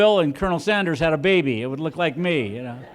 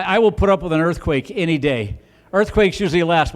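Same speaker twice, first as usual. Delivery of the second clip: ordinary talk, nothing marked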